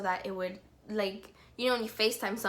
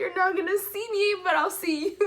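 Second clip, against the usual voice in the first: much higher, laughing through the words; laughing tease; 9 semitones higher, 4 dB louder than usual. Retold or imitated. Fan jokes to idol on call, high laughing tease. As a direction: giggle out the joke.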